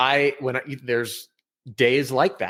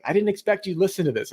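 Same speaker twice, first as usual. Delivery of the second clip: quick, much higher mock-surprised voice; surprised attendee; 8 semitones higher, faster than usual. A guest at his event, quick mock surprise. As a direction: play the startled guy, high and fast. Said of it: "He's like".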